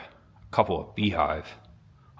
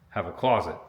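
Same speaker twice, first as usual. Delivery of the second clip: ordinary talk, nothing marked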